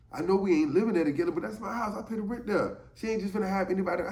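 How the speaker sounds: high, fast, worked-up complaint; agitated rant. Retold or imitated. Friend rants fast and high to Williams about his girl, agitated. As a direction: spit it out fast like a jealous boyfriend fuming.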